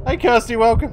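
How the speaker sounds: high, loud cheery greeting; excited welcome. Real, not acted. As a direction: call it out brightly. Greeting a viewer loudly.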